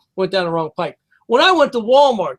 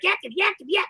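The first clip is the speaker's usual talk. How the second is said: high-pitched gibberish mimicry; mock foreign chatter; 9 semitones higher, 7 dB quieter, faster than usual. Man imitating shoppers' foreign talk at Walmart, mock chatter. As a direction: imitate rapid-fire yakking in an unknown language. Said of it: "what the fuck language they were speaking".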